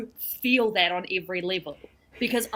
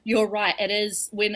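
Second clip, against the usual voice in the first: usual speaking voice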